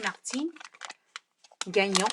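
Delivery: Martine Gagnon said the French way; French accent. Shown as a demonstration. Insisting the name should have an accent, French accent. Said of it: "it should have an accent".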